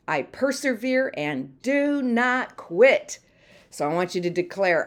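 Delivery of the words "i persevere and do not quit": "do not quit" slow, each word set apart and strong; stress on DO NOT QUIT. Shown as a demonstration. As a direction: hammer out "do", "not", "quit" one by one. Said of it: "put the emphasis on a different word".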